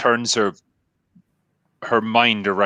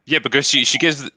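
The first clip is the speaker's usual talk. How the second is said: usual speaking voice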